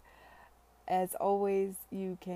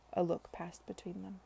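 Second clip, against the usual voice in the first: ordinary delivery, nothing marked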